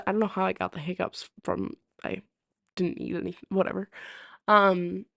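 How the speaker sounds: ordinary talk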